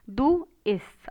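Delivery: ordinary talk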